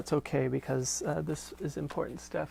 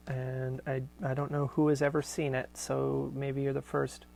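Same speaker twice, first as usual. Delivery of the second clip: ordinary talk, nothing marked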